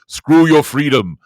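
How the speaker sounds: loud, slow sneering declaration; mock villain shout. Host voices the elites' attitude, mock sneering shout. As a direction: spit it out like a villain. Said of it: "It's like".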